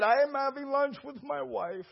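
high, emotional retold voice; imitated tearful gratitude. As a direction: act out a husband moved to tears. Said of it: "And he was crying".

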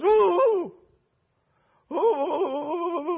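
high, drawn-out wavering "ooh" wail; imitated wailing. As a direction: act out her long, high moan. Imitates woman reacting to prayer.

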